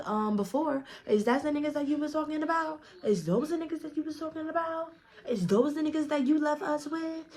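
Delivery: heated retold confrontation. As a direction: fire off the questions up high, accusing. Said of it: "I said".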